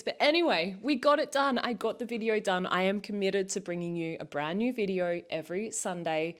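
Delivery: normal talking voice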